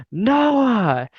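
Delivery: mock yell, pitched high; high-pitched imitation of a yelled name; retold yell. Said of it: "she fucking yells at me".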